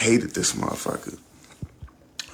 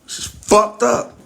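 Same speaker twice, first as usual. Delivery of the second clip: high, slow and loud, stretching out "up"; loud disbelief; 10 semitones higher, 3 dB louder, slower than usual. Real, not acted. Telling the audience how it felt, loud and drawn out. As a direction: let it ring out in shock. Stunned opening line.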